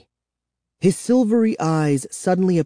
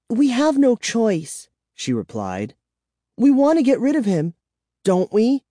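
anxious; 11 semitones higher than usual. A narrator's reading. Nervous child insists.

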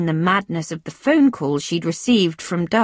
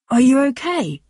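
higher-pitched, concerned question; 6 semitones higher than usual. A narrator's reading. Tom to Francesca as she arrives looking troubled, concerned. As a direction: ask with concern, pitch lifted. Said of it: "looking concerned".

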